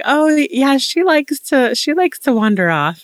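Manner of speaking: high, casual offhand parent; breezy mom. Retold or imitated. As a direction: toss it off like it's nothing. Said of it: "it was like".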